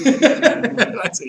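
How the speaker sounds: laughing through the words; high laughing speech. Real, not acted. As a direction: laugh as you go on. Laughs while retelling.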